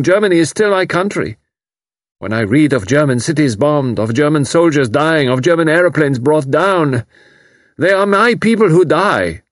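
put-on young German man moved to rage; torn, furious refugee. A narrator's reading. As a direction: build from anguish to fury. Karl rising to fury.

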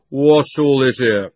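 slow, loud official voice; policeman. A narrator's reading. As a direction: demand order. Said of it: "the most important of the policemen".

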